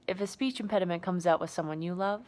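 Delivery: normal talking voice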